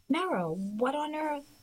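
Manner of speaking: a worried woman finding her at the door, drawn out; startled older woman. Acted.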